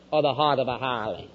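usual speaking voice

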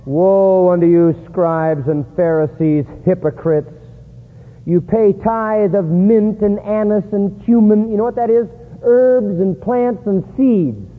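slow, weighty Bible reading; declaimed scripture. Acted. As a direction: pronounce the woe with force.

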